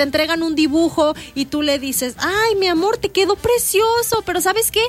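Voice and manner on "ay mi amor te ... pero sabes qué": high, sugary praise then a gentle correction; sweet mom voice. Retold or imitated. Mother to child about his drawing, sweetly high. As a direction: coo over the drawing, then slip in the fix.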